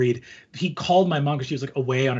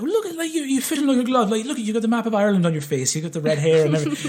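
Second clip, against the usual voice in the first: high, lightly voiced Irish local; imitating Irish woman; 10 semitones higher, faster than usual. Irish woman praising the visitor, high cheerful voice. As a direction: chirp it like a friendly local lady. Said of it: "one of them was like".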